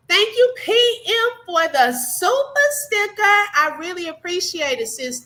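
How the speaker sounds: high, bright gushing thank-you; excited thanks. Real, not acted. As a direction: gush with delighted gratitude. Thanking viewer for super sticker.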